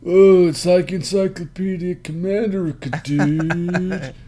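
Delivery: put-on high laid-back California surfer accent. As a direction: play a chill California surfer dude. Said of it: "that's surfer talk".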